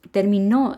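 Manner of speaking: terminó stressed on the final O; stress on last syllable. Shown as a demonstration. Teacher explaining that it is a verb, terminó stressed on final O. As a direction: say terminó as ter-mi-NÓ, weight on the end. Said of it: "se acentúa en la última O".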